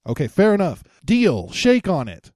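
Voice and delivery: ordinary talk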